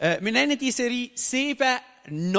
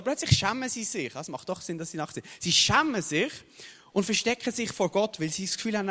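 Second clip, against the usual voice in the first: ordinary talk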